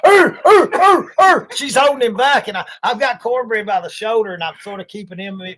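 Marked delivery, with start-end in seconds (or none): 0.0-1.5 high loud mock barking growl; imitated dog growl. Retelling the pit bull charging, a hammed-up growl. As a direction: bark like the snarling pit bull. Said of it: "he's going".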